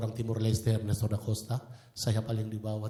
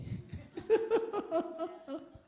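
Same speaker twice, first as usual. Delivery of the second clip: high laugh. Real, not acted. Laughing at own story.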